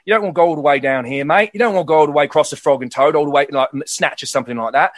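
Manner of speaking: quick, broad Cockney patter. Shown as a demonstration. Showing which English accent to avoid, too Cockney. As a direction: lay on a thick Cockney accent. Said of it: "don't wanna go too Cockney".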